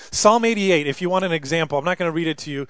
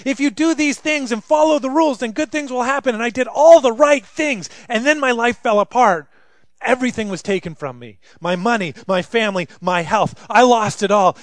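raised, high anguished protest; loud, higher bitter lament; Job's complaint; 5 semitones higher, 5 dB louder than usual. Acted. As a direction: plead your case as Job. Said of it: "And it said".

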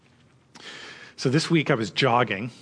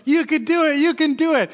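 bench cheerleader; 12 semitones higher than usual. Retold or imitated. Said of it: "and he goes".

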